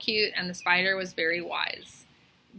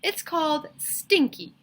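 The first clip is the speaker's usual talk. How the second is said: teacher voice; 8 semitones higher, 3 dB louder, slower than usual. A narrator's reading.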